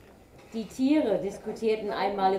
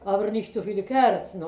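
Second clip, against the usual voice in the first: owl voice, loud and quick; howling owl; 7 dB louder, faster than usual. A narrator's reading. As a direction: hoot it out like an owl. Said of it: "heulte die Eule".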